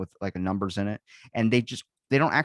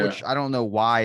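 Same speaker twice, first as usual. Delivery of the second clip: ordinary talk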